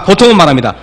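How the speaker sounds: ordinary talk, nothing marked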